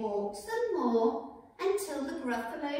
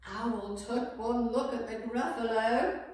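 plain reading voice

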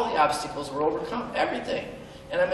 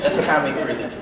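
usual speaking voice

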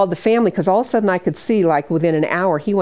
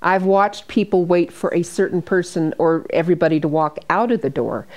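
usual speaking voice

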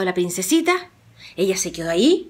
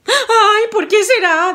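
much higher, louder innocent sigh; coy princess; 12 semitones higher, 6 dB louder, slower than usual. Acted. Rosa plays innocent before the giant, high and coy.

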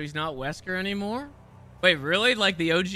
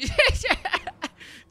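high, bursting laugh. Real, not acted. Laughing at the character grappling everywhere, amused. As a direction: crack up in a high giggle.

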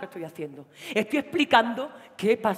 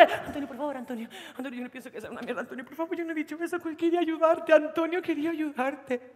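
higher pleading voice; pleading girlfriend; 6 semitones higher than usual. Acted. Girlfriend pleading with her angry boyfriend, pleading. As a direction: beg him to believe her.

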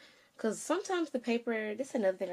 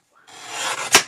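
exasperated wow; 13 dB louder than usual. Real, not acted. Phone storage full, exasperated.

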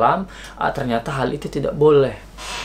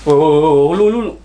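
mock threat; 3 dB louder, faster than usual. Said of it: "Apalagi gua bilang".

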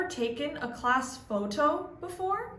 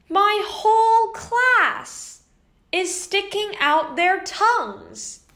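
excited punchline; 6 semitones higher, 5 dB louder than usual. Acted. Narrator revealing the whole class sticking out tongues, excited. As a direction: land the big funny reveal.

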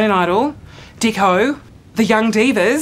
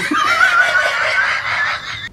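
high shrieking laugh. Real, not acted. Laughs at own joke.

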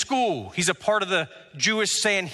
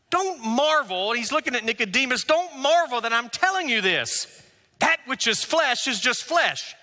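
preacher voicing Jesus to Nicodemus; preaching; 6 semitones higher than usual. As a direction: press each word on the listeners.

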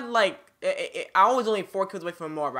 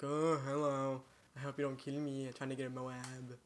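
mock naive greeting; 9 dB quieter than usual. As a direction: play yourself as meekly clueless. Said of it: "If only I wasn't stupid enough".

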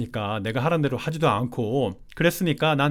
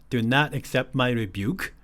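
slow verse reading; 2 semitones lower, 3 dB quieter, much slower than usual. Shown as a demonstration.

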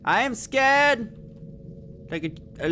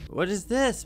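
ordinary delivery

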